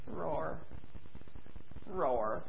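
tiny feeble roar; a roar with no power left; weak little roar. Acted. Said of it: "he went".

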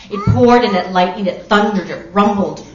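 loud, driving storybook narration; booming list, verb after verb; storm drama. A narrator's reading. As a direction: hammer each verb of the storm.

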